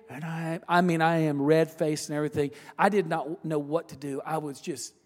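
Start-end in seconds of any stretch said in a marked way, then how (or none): none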